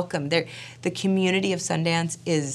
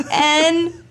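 high, loud drawn-out letter; mock-tearful spelling; 12 semitones higher, 8 dB louder than usual. Wife tattooing her husband, tearful wail.

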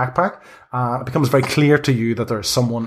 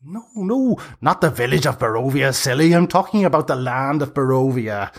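put-on high squeaky old hag calling down from the trees; high-pitched busy hag; 3 semitones higher than usual. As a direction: play a flustered old crone, pitched up high. Hag explains.

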